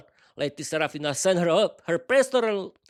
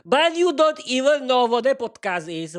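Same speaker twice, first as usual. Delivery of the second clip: higher, louder mocking husband voice; interrupting husband; 7 semitones higher, 5 dB louder than usual. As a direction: cut her off, teasing. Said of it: "Her husband immediately interrupted her".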